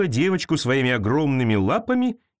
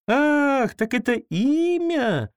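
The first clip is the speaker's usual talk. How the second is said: put-on high, slow girlish surprise; a little girl realising; girl smiles at creature's name; smiling girl; 11 semitones higher, much slower than usual. A narrator's reading. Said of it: "улыбнулась девочка".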